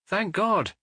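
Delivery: high burst of relief; relieved cry. A narrator's reading. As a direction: cry out thankfully. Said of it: "he cried".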